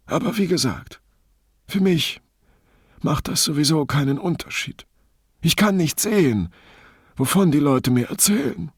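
high, plaintive voice with halting pauses; self-pitying and theatrical, phrase by phrase; long-suffering blind man. Acted. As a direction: play the poor victim, pitched well up.

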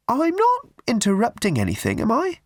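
put-on a prim, simpering old charity lady pushing tickets; high twittering church-fete lady; raffle woman. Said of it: "She peered anxiously".

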